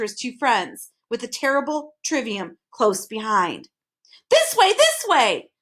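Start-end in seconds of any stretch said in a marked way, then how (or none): 4.3-5.5 slow, much higher calling voice. A narrator's reading. Hidden voice guiding the boys, calling out. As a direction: call them over from a distance. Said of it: "the voice called again".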